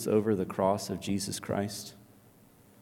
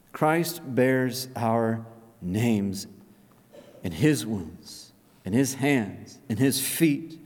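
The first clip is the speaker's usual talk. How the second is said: slow reverence; 3 semitones lower, slower than usual. Acted.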